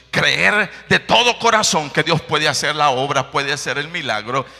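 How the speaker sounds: loud, building sermon; fervent preaching. Real, not acted. Preacher exhorts his brothers in faith, loud and fervent. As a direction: preach it with rising fervor.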